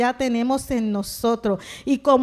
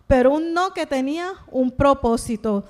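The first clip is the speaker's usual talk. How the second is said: ordinary talk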